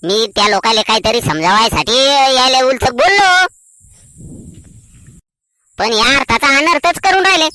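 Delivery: long-drawn, wailing self-defence; plaintive protest. Acted. Man defends himself to sister-in-law, plaintively protesting. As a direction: stretch the line endings, climbing in pitch.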